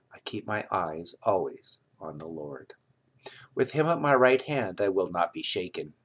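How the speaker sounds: normal talking voice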